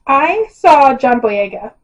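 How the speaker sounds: ordinary talk, nothing marked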